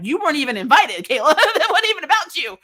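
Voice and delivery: high, loud laughing mock rebuke; laughing mock rebuke aimed at Kayla, high and loud; laughing scold. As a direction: scold her through laughter.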